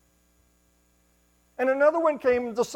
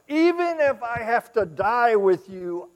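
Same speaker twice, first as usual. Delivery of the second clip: bold vow; 9 semitones higher, 13 dB louder, slower than usual. Acted. Peter vowing.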